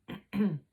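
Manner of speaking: a real, loud clearing of the throat. Real, not acted. Reader pausing the story to clear a dry throat. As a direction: clear your throat hard before going on.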